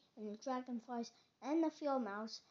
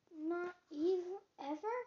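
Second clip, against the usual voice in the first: higher, louder, astonished voice; surprised, pitched-up asking; incredulous question; 6 semitones higher, 6 dB louder than usual. Acted. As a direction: ask it in raised-pitch disbelief. Frog is astonished Toad gets no mail.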